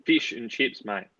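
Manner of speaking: broad Australian "Fish and chips" with a short clipped fish; strong Aussie accent. Shown as a demonstration. Mate answers the fans' request, in a broad Australian accent. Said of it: "very strong, very strong".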